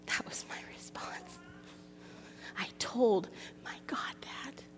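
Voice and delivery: hushed, breathy whisper; whispered confession. Real, not acted. Preacher confessing to the ladies what she told God, whispering. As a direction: whisper it like a shameful secret.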